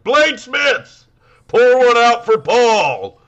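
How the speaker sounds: slow, high, loud mock proclamation; show host proclamation. Podcaster as host addressing the bladesmiths, booming mock solemn call. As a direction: draw out a hammed-up host's tribute.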